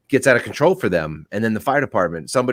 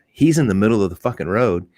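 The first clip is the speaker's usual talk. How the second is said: ordinary talk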